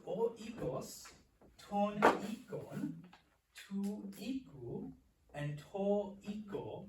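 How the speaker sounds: drawn-out, evenly spaced noun forms; slow recitation. Shown as a demonstration. Teacher lists the word's case forms, slow recitation. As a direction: recite each form slowly and deliberately.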